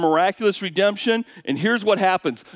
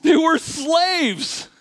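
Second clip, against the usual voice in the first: "They were slaves" high and very slow; emphatic; 12 semitones higher, much slower than usual. Real, not acted. Preacher drives home to the congregation, slow and high. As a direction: drag out "They were slaves" with every word hit. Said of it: "emphatic rhetorical point".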